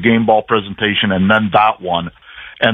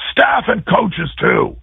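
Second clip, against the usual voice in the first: retold coach call; 11 semitones higher, much slower than usual. Coach summons staff too.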